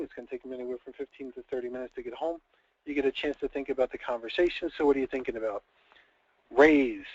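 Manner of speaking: normal talking voice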